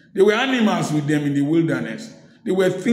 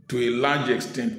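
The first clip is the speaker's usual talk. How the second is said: ordinary delivery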